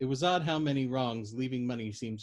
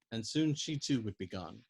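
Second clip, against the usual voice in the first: ordinary delivery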